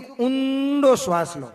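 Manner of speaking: very slow, with "ઊંડો" stretched long; drawn-out yoga instruction. Acted. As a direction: imitate a yoga guru, holding out "ઊંડો". Yoga guru's breathing instruction acted out. ("ઊંડો" ખૂબ લંબાવીને, યોગગુરુની જેમ ધીમેથી)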